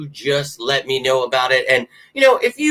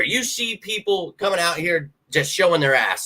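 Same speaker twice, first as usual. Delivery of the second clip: ordinary talk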